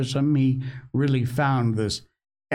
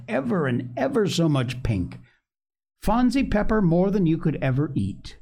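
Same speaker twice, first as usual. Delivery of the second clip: high, growing enthusiasm; warming to it; 6 semitones higher than usual. A narrator's reading. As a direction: get carried away promising a treat. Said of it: "warming with her subject".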